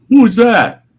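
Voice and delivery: sudden loud high who's that; jolted awake and demanding; startled question. Retold or imitated. Groggy man woken by camera.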